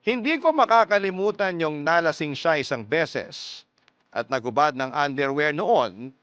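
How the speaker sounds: ordinary talk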